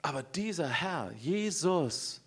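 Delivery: ordinary talk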